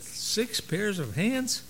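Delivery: slow, rising disbelief; the angel can't believe it, drawn-out question; incredulous. Acted. Angel doubting God's design, slow incredulous rise. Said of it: "The angel shook her head slowly and said".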